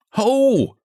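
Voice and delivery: high, strangled little-man yelp; a bedraggled little man crying out; high stifled cry. A narrator's reading. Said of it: "cried the little man, stifled and bedraggled".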